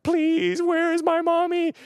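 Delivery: put-on high, teary little-kid plea to the lifeguard; a sobbing five-year-old begging for his mommy; little lost boy. Lost five-year-old begging a lifeguard, high and teary. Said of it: "and I'm, I'm sobbing".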